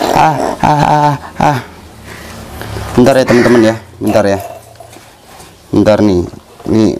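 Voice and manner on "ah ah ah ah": sharp high yelps of pain; short pained ah sounds, real; pained cries. Real, not acted. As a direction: cry out as it hurts. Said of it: "sakit loh".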